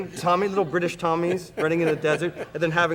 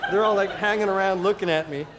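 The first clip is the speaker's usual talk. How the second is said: ordinary talk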